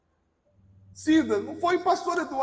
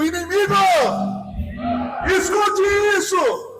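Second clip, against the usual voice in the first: slow, high-energy preaching in short bursts; forceful shouted declaration; 4 semitones higher, much slower than usual. Real, not acted. Preacher leading the congregation against the enemy, forceful shouted declaration. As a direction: hurl each phrase out and hold its ending.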